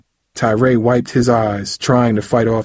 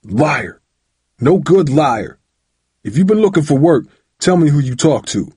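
raised, scornful father's voice; angry accusation; 6 semitones higher, 4 dB louder than usual. A narrator's reading. Father challenges son's story about looking for work, angry. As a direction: lay into him as a liar.

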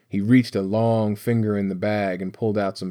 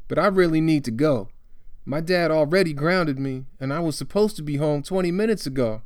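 put-on higher-pitched boy's voice, hurrying by the end; Tyree, pleading; 7 semitones higher than usual. Grounded boy overdue at home, pleading.

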